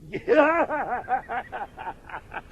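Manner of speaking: scornful laugh; mocking laugh. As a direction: laugh him off with contempt. Brother mocks the parson.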